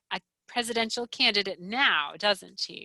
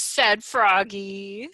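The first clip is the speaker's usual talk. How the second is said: ordinary delivery, nothing marked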